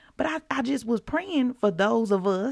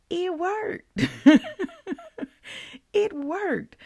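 excited; 11 semitones higher, 4 dB louder, slower than usual. Real, not acted. Thrilled prayer worked.

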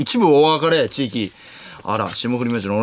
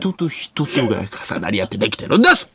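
lower, hammed-up teacher sermon; 5 semitones lower than usual. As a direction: preach it like a parody TV teacher. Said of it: "[name]みたいにできるやつもある".

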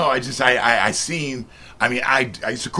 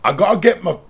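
amateur attempt at an English accent; hammed-up English impersonation; 3 semitones higher, 4 dB louder than usual. Shown as a demonstration. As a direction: put on your best English accent. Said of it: "Can you do an English accent?".

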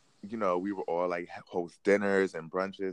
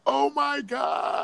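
high, slow, drawn-out oh my gosh; a stretched shriek of disbelief; shocked cry; much slower than usual. Retold or imitated. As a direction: hands going behind her back, a high shocked wail. Told to put hands behind.

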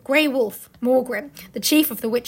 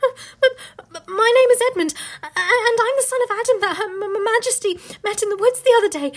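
put-on high, loud, stammering frightened boy; terrified stammer, catching on first sounds; trembling; 9 semitones higher, 4 dB louder than usual. A narrator's reading. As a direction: stutter nervously through a pitched-up plea. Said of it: "trembling so that he could hardly speak".

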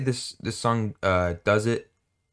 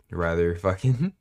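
ordinary delivery

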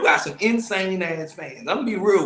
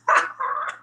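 high laugh. Real, not acted. Laughs at his joke.

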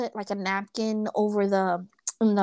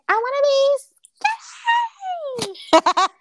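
high, loud, giggly parody of a dieter; mock the shallow dream of being skinny; mocking skinny girl voice; 6 dB louder, much faster than usual. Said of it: "what is that thing?".

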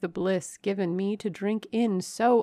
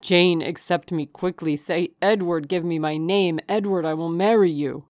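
loud, urgent plea; impassioned demand at raised volume; wild urgency; 8 dB louder than usual. A narrator's reading. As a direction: beg her insistently, without restraint. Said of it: "and added wildly".

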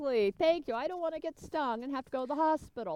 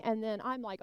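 ordinary delivery

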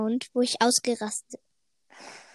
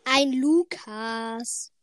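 drawled; 6 dB louder, slower than usual. Real, not acted. Repeated drawled answer.

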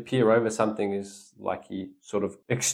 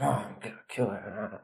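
softer, under-the-breath grumble; muttering; 8 dB quieter than usual. Shown as a demonstration. Teacher showing a student how Jack grumbles to himself, muttering. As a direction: mutter it to yourself, words half swallowed. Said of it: "you mutter under your breath".

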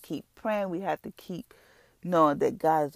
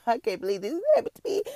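much higher, put-on whiny panic; frantic pity party; 9 semitones higher, 3 dB louder, faster than usual. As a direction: throw a dramatic fit at God. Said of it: "go into this frantic and have a pity party".

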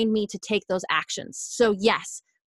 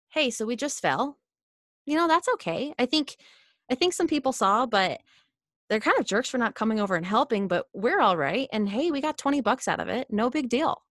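calm and mellow; 3 semitones higher than usual. Acted. Positive inner voice consoling herself after a fall, calm and mellow. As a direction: shrug it off gently, no big deal. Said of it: "is really calm and mellow".

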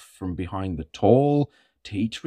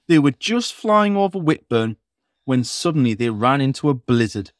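plain reading voice

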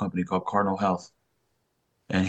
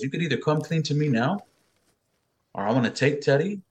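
higher-pitched imitation of a threatening wife; retold marital threat, pitched up; angry ultimatum; 7 semitones higher than usual. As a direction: lay down an ultimatum, indignantly. Mother giving father an ultimatum.

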